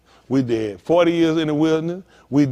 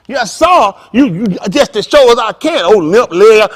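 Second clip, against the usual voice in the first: eager servant; 4 semitones higher, 9 dB louder than usual. Retold or imitated. Acting the dancing servant.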